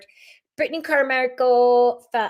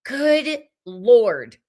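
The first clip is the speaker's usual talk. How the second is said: normal talking voice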